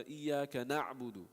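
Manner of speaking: Iyyaka na'budu recited slowly with the ayn flowing; measured Quran recitation. Shown as a demonstration. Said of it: "for example, in Surah Fatiha".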